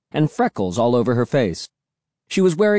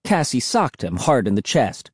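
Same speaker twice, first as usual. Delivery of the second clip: ordinary delivery, nothing marked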